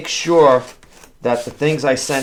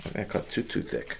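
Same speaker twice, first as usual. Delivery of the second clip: "think I cut too, too thick" breathed out unvoiced and much softer; muttered under breath; 3 semitones lower, 13 dB quieter than usual. Real, not acted. Packer grumbling to himself over the foam, under his breath. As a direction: mutter "too thick" to yourself, almost silent.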